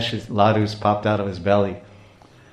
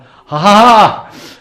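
loud, high exploding laugh-cry; ecstatic outburst; 9 semitones higher, 13 dB louder, much slower than usual. Acted. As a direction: burst out in acted ecstasy. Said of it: "And he explodes".

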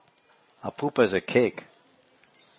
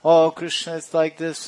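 a hint of gushing gopis, pitched higher; lightly voiced gopis; 6 semitones higher, 4 dB louder than usual. Gopis distracting Krishna while dancing, lightly voiced, higher pitch. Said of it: "they're speaking like this".

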